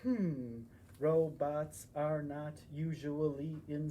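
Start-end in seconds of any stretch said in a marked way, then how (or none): none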